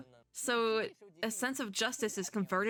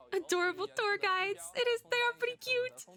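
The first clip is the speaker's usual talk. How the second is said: very high, cooing delight; cutesy squeal; 13 semitones higher than usual. Real, not acted. Streamer cooing at the adorable tour guides, very high. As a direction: coo it in a tiny high voice.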